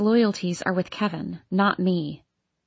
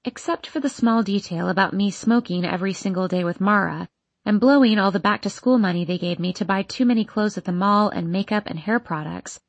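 plain reading voice

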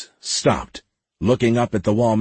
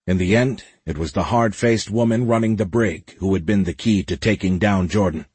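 ordinary delivery, nothing marked

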